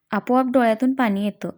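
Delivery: ordinary delivery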